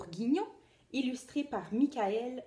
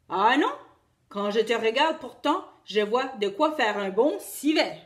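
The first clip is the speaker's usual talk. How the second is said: loud, hungry big bad wolf; greedy wolf; 8 dB louder than usual. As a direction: relish the meal to come. Wolf answers rabbit.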